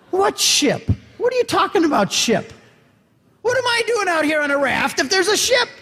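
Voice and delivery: high, disbelieving questions; rising pitch disbelief at a ship; incredulous. Retold or imitated. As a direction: sound baffled there could be a ship. Incredulous retort about the ship.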